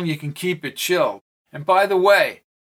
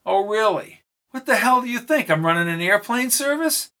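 sarcastic; 6 semitones higher than usual. Acted.

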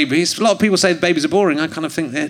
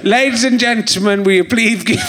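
hammed-up begging, higher; pleading for TV; 4 semitones higher than usual. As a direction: beg to be on telly. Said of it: "Massive twat".

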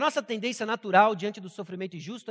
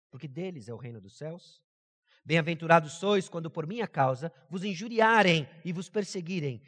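scripture reading; 4 semitones lower than usual. Acted. Reads the Beatitudes aloud.

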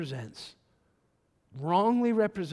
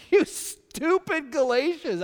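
much higher and loud, slow, outraged; exasperated scolding; 10 semitones higher, 7 dB louder, slower than usual. Retold or imitated. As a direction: fling it out like an outraged apostle. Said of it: "How you like that in the Bible?".

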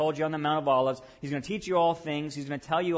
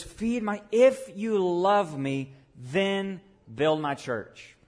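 slow, weighty, louder on "if you love me"; slow emphatic charge; 4 semitones higher, 3 dB louder, much slower than usual. Acted. Jesus charging Peter, slow and weighty. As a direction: lay each word down like a commission. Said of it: "He says".

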